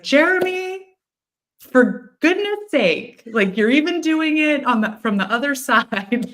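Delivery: high, loud mock-exasperated cry of a name; exasperated scolding. A woman playfully scolding her friend's spirit, loud exasperated mock scolding.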